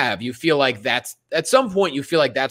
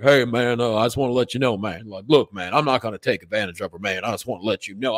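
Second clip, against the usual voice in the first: a touch of a smooth [name] guy; mild lower [name]-style voice; lightly voiced [name] impersonator; 6 semitones lower, 4 dB quieter than usual. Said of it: "sounds like a great [name] impersonator".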